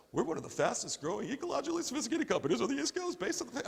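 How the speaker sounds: rattled off fast like a corporate blurb; mock brochure copy. Mocking how companies sound in their copy, fast corporate blurb. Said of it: "Who talks like a brochure?".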